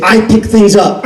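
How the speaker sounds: giggling mantra while being hauled out; laughing repeat. Retold or imitated. Dragged to the door, laughing the phrase.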